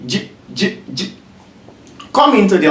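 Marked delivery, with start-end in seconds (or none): 0.1-1.1 J whispered short with no vowel; bare consonant J. Shown as a demonstration. Teacher repeating a bare J for the class. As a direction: breathe out J with no vowel. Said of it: "just like the letter J".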